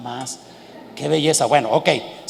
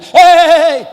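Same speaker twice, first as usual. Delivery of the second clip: high, loud Don Ramón calling out; Don Ramón yell; 8 semitones higher, 10 dB louder than usual. Retold or imitated. Father to son, loud mock Don Ramón shout. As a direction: belt it like Don Ramón scolding. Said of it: "como don Ramón a la chilindra".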